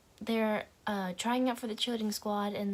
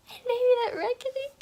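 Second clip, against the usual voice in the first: very high, squeaky monologue take; silly high voice; 7 dB louder, faster than usual. Shown as a demonstration. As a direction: push it way up into a squeak.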